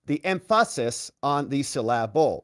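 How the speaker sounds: emphasis as em-FA-sis, syllable as suh-LAB-ul; wrong-footed stress on emphasis and syllable; joke mispronunciation. Shown as a demonstration. Host joking on stress, em-FA-sis on the suh-LAB-ul. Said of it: "hard to tell which syllable".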